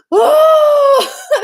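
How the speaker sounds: a high, loud cry that breaks out; sudden sob. Retold or imitated. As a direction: let the tears burst out on this word. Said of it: "and I just started crying".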